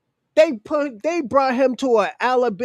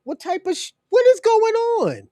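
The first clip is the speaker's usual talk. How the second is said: high-pitched, exasperated disbelief; incredulous outburst; 11 semitones higher than usual. Real, not acted. Ranting about supremacists storming barbershops, incredulous. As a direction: cry out in bafflement, stretching the words.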